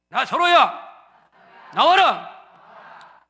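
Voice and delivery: loud, very slow call. Shown as a demonstration. Congregation repeating the call loudly after the pastor. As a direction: draw it out loudly. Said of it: "크게 하세요".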